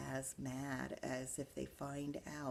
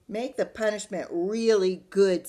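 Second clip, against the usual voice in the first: much louder, eager little sister; gleeful D W; 5 semitones higher, 15 dB louder than usual. Acted. Little sister urging Mom to punish Arthur, much louder. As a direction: relish the punishment.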